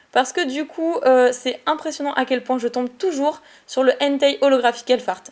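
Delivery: normal talking voice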